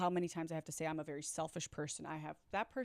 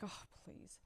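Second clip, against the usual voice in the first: retold scoff, dropped to a near-whisper; very soft, breath-only brush-off; dismissive disbelief; 15 dB quieter than usual. Retold disbelieving reaction to compliment.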